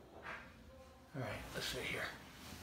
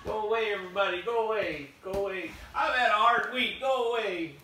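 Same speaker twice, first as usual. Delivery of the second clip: raised, higher-pitched plea to be left alone; put-upon, pleading dismissal; grumpy protest; 4 semitones higher, 4 dB louder than usual. Pretend. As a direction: whine at being woken from a nap. Roused from nap, shooing everyone.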